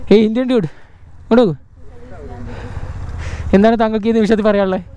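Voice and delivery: high playful reporter voice; mock interviewer. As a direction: fire the question like a TV anchor.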